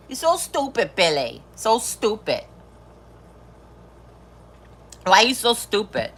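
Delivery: a light hint of a Rosie Perez movie voice, scolding; Rosie Perez impression. Riffing on a movie line for viewers, lightly voiced Rosie Perez scolding. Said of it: "That's my best Rosie Perez".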